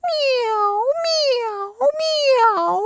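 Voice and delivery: high, soft drawn-out mews; three small sad cat cries; sighing kitten meows. A narrator's reading. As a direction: mew softly like a sorry kitten. Kittens mew sadly when scolded.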